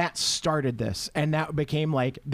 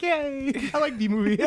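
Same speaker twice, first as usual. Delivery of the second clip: put-on high, childlike little yay; hammed-up happy squeal; mock giddy cheer; 10 semitones higher than usual. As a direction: imitate your own delighted reaction, pitched up. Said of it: "I was like".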